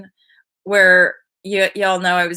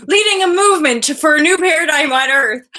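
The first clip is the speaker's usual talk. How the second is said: high, loud playful declaration; hammed-up rallying cry; mock-grand proclamation; 7 semitones higher, 6 dB louder than usual. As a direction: proclaim it big and high-pitched. Mock-grand statement of her priority.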